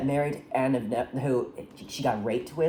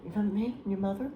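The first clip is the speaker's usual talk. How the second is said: soft, high, hurried plea; small raised voice, imitating a mother; acted pleading; 7 semitones higher, 5 dB quieter, faster than usual. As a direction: plead quietly as a worried mother. Voices mother pleading with absent son.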